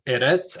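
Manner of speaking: "edits" with the last syllable stressed; ed-ITS, "edits" with end stress; stress at the back. Shown as a demonstration. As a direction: push the second syllable of "edits". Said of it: "at the back of the word".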